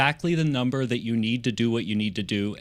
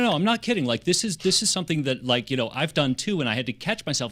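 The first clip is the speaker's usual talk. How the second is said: normal talking voice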